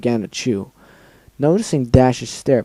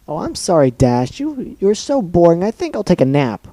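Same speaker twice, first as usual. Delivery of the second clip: high mocking sing-song apology; mock her sarcastically; mocking Pinkie; 6 semitones higher than usual. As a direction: Pinkie mocking the sleepy Dash.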